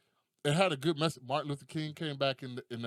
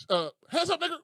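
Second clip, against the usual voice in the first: high, loud order; mock police command; 14 semitones higher, 6 dB louder than usual. Cops confront the two men, mock shouted order. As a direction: call it out like a comic cop.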